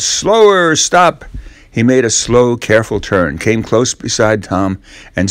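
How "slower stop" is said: higher called commands; captain's orders. Acted. Ben as captain ordering the boat to stop, calling out. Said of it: "he shouted".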